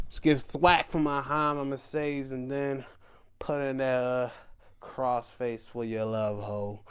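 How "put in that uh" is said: usual speaking voice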